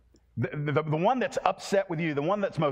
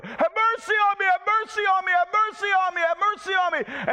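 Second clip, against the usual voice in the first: much higher, louder repeated scream; screaming for mercy; 5 dB louder than usual. Acted. Beggar screaming at the roadside to Jesus, a high loud scream. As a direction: wail it out, over and over. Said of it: "screaming over and over and over".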